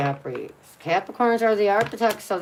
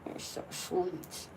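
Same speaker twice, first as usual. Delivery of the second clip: whispering; 12 dB quieter, slower than usual. Real, not acted. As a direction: whisper it to a pet. Cooing to pet.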